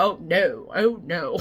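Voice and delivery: emphatic retold "oh no"; an exaggerated oh-no reaction; retold dismayed denial. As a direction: replay your own dismay, drawn out. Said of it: "I think I said it like that".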